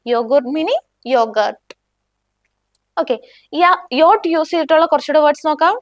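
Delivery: usual speaking voice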